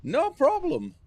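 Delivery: "No problem." leaps very high and loud; squeaky Scottish punchline. Retold or imitated. Streamer copying the dwarf's punchline, high and loud. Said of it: "I could work on my Scottish accent".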